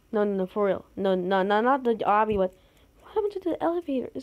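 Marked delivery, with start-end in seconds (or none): 3.0-4.1 quick higher rattled-off question; fast high repeat; fast insistent question. Real, not acted. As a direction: rush it out insisting. Insisting not the obby.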